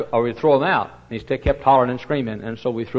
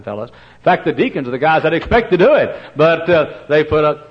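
high, loud joking preacher; loud preaching; 4 semitones higher, 8 dB louder than usual. Acted. Preacher to congregation, loud and joking. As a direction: push it out to the back pews.

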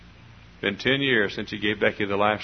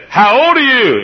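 put-on beam down at a little girl; old preacher greeting a child; 14 semitones higher, 12 dB louder than usual. Old preacher cooing at a little girl, high and loud. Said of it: "and he said".

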